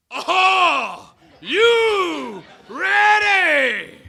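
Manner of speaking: slow, high, drawn-out stadium shout; screamed call. Retold or imitated. High school captain screaming to the team, slow and high. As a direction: belt it out to the huddle. Building chant, tension rising.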